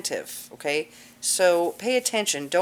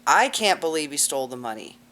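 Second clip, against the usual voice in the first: "I" stressed, then a falling tune; 2 semitones lower than usual. Shown as a demonstration. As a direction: lean hard on the word "I". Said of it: "everybody emphasizing a different word".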